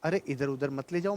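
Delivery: ordinary delivery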